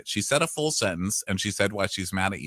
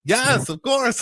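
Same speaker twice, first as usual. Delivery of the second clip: cheerful yes; 13 semitones higher, 4 dB louder, slower than usual. Retold or imitated. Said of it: "he's like".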